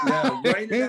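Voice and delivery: slow, laughing retort. Real, not acted. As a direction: laugh out the comeback. Laughing at book joke.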